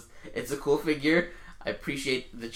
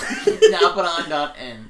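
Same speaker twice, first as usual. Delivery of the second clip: laughing; 4 semitones higher, 12 dB louder, much slower than usual. Real, not acted. Teasing the spelling struggle.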